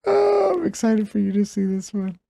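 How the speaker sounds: high giggly excited storytelling; laughing excitedly. Real, not acted. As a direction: laugh through it, barely holding it.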